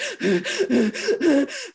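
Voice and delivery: sharp frightened gasp; panicked gasp. Acted. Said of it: "panicked breathing".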